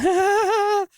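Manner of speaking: high mocking "ha ha ha" right in his face; mocking laugh. Retelling a coked-out stranger laughing in Craig's face, high mock laugh.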